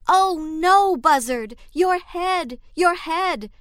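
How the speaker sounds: high, loud panicked cry; alarmed cry. Acted. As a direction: shriek at the smoking head. Animals shout in alarm.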